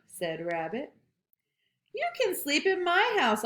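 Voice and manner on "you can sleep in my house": squeaky-high kind squirrel. Acted. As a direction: offer kindly as a tiny squirrel. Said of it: "offered Squirrel".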